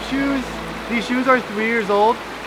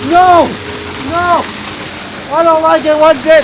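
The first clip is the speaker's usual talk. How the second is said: distressed yelling; 5 semitones higher, 6 dB louder than usual. Real, not acted. Slipping under waterfall.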